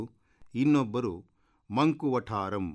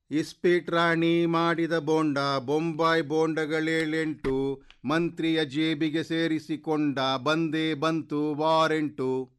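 called-out proclamation; 8 semitones higher, 4 dB louder than usual. A narrator's reading. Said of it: "ಎಂದು ಕೂಗಿ ಹೇಳಿತು".

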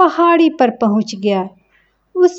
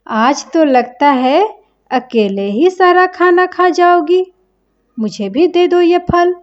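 higher, wheedling parrot demanding food; pushy parrot; 6 semitones higher than usual. Acted. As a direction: coax and bully the fruit out of her. Parrot demands squirrel's fruit. (ऊँची आवाज़ में फल माँगता तोता)

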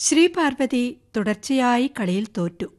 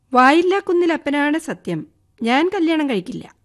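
higher, louder sworn oath; sudden oath; 6 semitones higher, 3 dB louder than usual. Acted. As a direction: swear it out all at once. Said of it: "പെട്ടെന്ന് ആണയിട്ടു".

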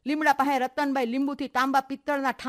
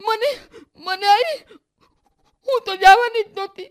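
very high, loud, slow words broken by sobs; sobbing with hiccups; 13 semitones higher, 9 dB louder, slower than usual. Acted. Daughter tells mother of the beating, sobbing very high and loud. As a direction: confess the assault through gasping sobs. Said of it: "હિપકા ભરતી હતી ને બોલતી હતી".

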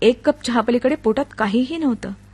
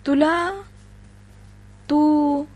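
stammering; 5 semitones higher, much slower than usual. A narrator's reading. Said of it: "त्यानं चाचरत विचारलं".

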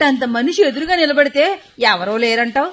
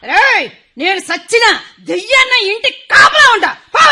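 loud furious threat; threatening shout; 5 semitones higher, 4 dB louder than usual. Acted. Old woman shouts threats at the son-in-law. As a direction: bellow a threat to drive him off.

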